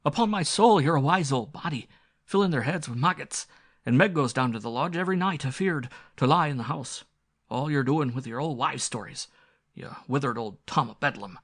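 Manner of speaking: scolding squire. Acted. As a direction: berate the old butler like a hot-tempered squire. Squire scolds butler Cooper.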